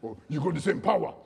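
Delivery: lightly voiced declaring father; imitating dad. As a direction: lightly put on his dad's emphatic "power". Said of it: "That's the way he always says it".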